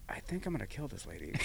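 fast, very soft aside; mock-menacing under the breath; retold seething thought. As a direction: voice a murderous thought quietly.

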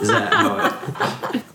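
high laughing question. Real, not acted. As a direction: break up laughing mid-question. Laughing after the joke.

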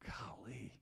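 breathy whispered golly; whispered awe. Retold or imitated. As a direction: whisper it to yourself, stunned. Private thought at fifty readings.

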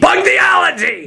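high, slow shouted announcer. Shown as a demonstration. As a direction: boom it out like an announcer. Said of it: "I'm stuck".

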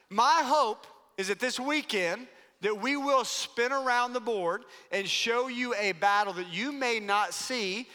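ordinary delivery

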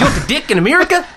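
a high, nasal mock Kiwi question; hammed-up Kiwi accent. Shown as a demonstration. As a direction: push the Kiwi accent high with a rising end. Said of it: "you need to be a little more nasally".

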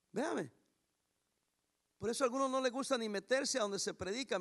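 ordinary talk

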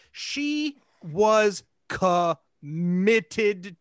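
"she was committed" drawn out very slowly; emphatic. Real, not acted. Telling friends how committed she was, "committed" slowly drawn out. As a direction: break "committed" into slow, weighty syllables. Stressing her total commitment to the story.

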